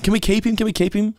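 high-pitched eager begging; pleading. Retold or imitated. Begging to keep the dog, eagerly pleading. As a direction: imitate an excited plea, pitch way up.

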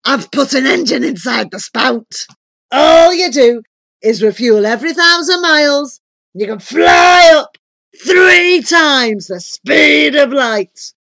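loud giant building to a slow shout; thunderous. A narrator's reading. Giant wizard boasts of his engine, thunderous. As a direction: boom it, growing bigger to the end. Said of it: "came a thunderous reply".

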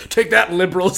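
loud laughing mock scream. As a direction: yell it gleefully. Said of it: "I scream".

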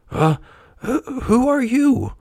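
slow, high, halting puppet voice; groggy mumble. A narrator's reading. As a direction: stumble groggily over the words, high. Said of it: "mumbled Pinocchio".

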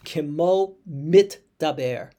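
Kimo mitdaber spoken slowly, t and d both clear; slow and loud, spell out mit-daber; slow quoted Hebrew. Shown as a demonstration. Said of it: "He says".

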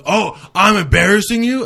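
high-pitched, indignant mock outburst; retold flip-out. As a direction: imitate a friend flipping out, pitch jumping up. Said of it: "flipped out".

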